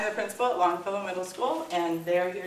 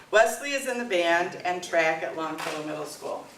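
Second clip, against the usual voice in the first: normal talking voice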